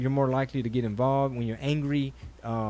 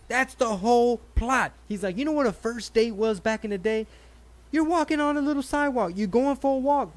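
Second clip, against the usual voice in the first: a pitched-up comedian rant, lightly voiced; imitating Colin Quinn; 9 semitones higher, 3 dB louder than usual. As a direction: riff it like a stand-up bit on stage.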